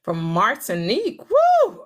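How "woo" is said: loud high woo; excited whoop. Real, not acted. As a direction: cheer it out. Cheering a viewer's news.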